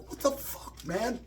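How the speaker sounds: high whispered mock disgust; disgusted shock. Brother-in-law hearing it's a stool sample, mock disgusted whisper. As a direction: imitate him recoiling, breathy and high. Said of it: "He's like".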